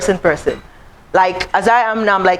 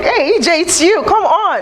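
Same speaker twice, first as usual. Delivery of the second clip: friend cheering her on; 9 semitones higher than usual. Retold or imitated. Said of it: "He's like".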